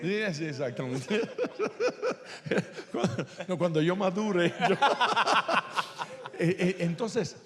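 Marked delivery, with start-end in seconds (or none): 1.2-2.9 high, breathy burst of laughter; real laugh. Real, not acted. Laughing at own joke. 4.8-6.3 loud burst of laughter; real laugh. Real, not acted. Laughing at own joke.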